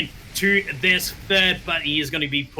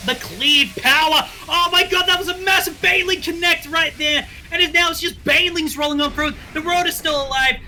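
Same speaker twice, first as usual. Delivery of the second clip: higher, louder hype call; excited shout; 12 semitones higher, 5 dB louder than usual. Acted. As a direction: yell the big Baneling hit. Live play-by-play climax.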